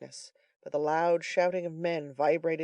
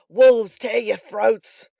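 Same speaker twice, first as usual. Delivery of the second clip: much louder, slow, higher curse; menacing curse; 6 semitones higher, 12 dB louder, much slower than usual. A narrator's reading. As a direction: snarl it through your teeth. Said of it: "muttered Sikes, grinding his teeth".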